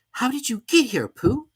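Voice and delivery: put-on high, eager boy's voice; an excited little boy; Christopher Robin voice. A narrator's reading.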